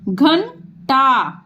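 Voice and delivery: घंटा stretched syllable by syllable; slow sounding out. Shown as a demonstration. Teacher to pupils, sounding out घंटा. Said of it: "जैसे ये हम दिखा रहे हैं".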